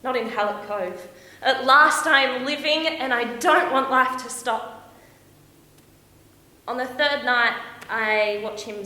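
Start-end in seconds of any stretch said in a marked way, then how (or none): 1.4-4.9 loud, soaring joy; elated and emphatic, slowing at the end; exultant. Acted. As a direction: proclaim it, full of life. Exults in new romance.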